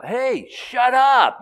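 high, loud shouted hush; yell. Retold or imitated. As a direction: shout down the arguing man.

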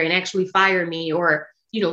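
ordinary delivery

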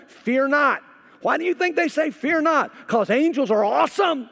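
high, loud, driving sermon voice; full-force preaching. Acted. Pastor preaching to his congregation about fearsome angels.

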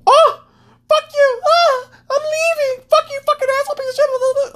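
very high, squealing tantrum voice; shrieking meltdown. Retold or imitated. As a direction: squeal it like someone storming off offended. Shrieking offended person.

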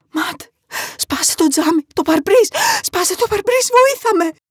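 loud, high, frantic scream; desperate mother's high shriek; screaming for help. A narrator's reading. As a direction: beg him at full panic to smash it. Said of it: "ουρλιάζοντας με όλη της τη δύναμη".

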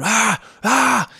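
loud high shouts to scare them off; imitating a mate barking at sharks; yelling at sharks. Diver shouts to chase sharks off his fish, loud yells. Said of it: "Yelling at these sharks".

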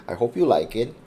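ordinary talk, nothing marked

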